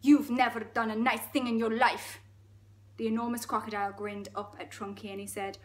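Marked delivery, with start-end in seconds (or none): 0.0-2.2 put-on full Russian accent. Elephant challenging the crocodile's plans, Russian accent. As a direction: accuse in a thick Russian accent. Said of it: "It's gone full Russian now".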